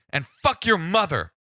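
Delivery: mock angry insult. Caller insulting the cop's mother, hammed-up angry. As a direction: spit it out as the caller. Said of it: "the caller goes".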